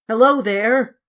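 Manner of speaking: loud, high hail from above; shouted from the tower. Acted. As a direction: call down from the church tower. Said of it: "shouted Cyril from the church tower".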